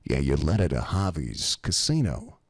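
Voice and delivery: low East Coast wise-guy voice. Retold or imitated. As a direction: say it like a knowing mobster. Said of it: "just like this. He goes".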